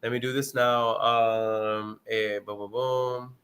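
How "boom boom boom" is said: ordinary delivery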